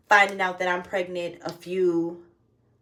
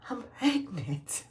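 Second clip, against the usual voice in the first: soft reveal; 3 semitones higher, 8 dB quieter, much slower than usual. Retold or imitated. Telling boyfriend the news.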